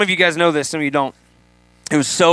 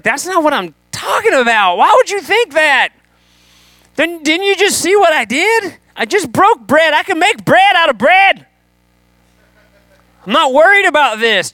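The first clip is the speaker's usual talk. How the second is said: much higher, louder exasperated protest; exasperated Jesus; 10 semitones higher, 3 dB louder than usual. Retold or imitated. Jesus scolds the disciples over bread, exasperated and high. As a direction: throw up your hands at them, pitch way up.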